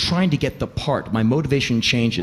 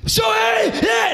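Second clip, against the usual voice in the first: very high, screamed hey; hammed-up crazed audition screaming; manic yelling; slower than usual. Actor terrorises director during audition, manic screaming. Re-enacting his over-the-top audition.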